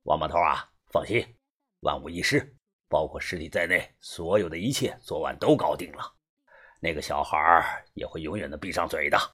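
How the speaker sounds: low-voiced man reporting to a boss; middle-aged fixer, assured. (中年男人低声笃定地汇报)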